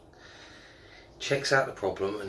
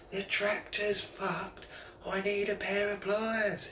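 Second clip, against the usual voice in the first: slow, much higher call across the field; calling up the hill; 9 semitones higher, 6 dB quieter, slower than usual. Retold or imitated. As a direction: stretch the call out to carry. Farmer calling a third time.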